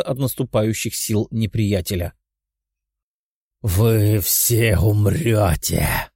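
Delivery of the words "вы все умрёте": slow, deep monster roar; roaring lizard. Acted. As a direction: drag out each word like a beast. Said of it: "проревел ящер".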